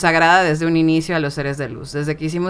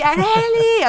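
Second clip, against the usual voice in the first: high called name, falling at the end; mock calling out; slower than usual. Voice waking her for work, high falling call. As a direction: sing out the name like someone at the door. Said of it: "pero así".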